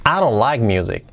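quick, linked phrase; brisk natural-speed model. Shown as a demonstration. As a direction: run it together fluently. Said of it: "Dicho más rápido".

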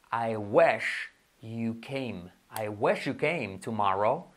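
very slow, drawn-out English example; slow model sentence. Shown as a demonstration. Teacher models English example for learners, slowly. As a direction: spell out each word unhurriedly.

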